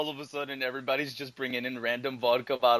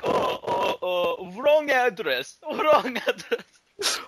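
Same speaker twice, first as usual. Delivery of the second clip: flustered high put-on Boris voice, laughing at the end; mock Boris; 9 semitones higher, 5 dB louder, much slower than usual. Boris caught at the door, flustered and high. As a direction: play a panicky Boris covering his tracks. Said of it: "Boris is like".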